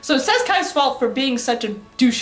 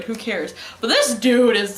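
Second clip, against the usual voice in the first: usual speaking voice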